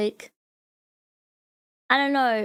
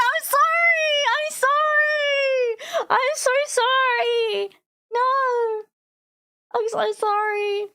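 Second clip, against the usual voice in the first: wailing apology; 11 semitones higher, 4 dB louder, slower than usual. Acted. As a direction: wail sorry over and over like a fake-sobbing kid, then trail off. Hammed-up apology after insulting Thomas.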